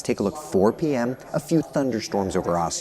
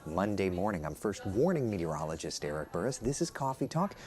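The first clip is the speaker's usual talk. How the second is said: whispering; 2 semitones lower, 9 dB quieter than usual. Real, not acted. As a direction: keep it down, news is on nearby. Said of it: "I have to whisper because they're doing the news".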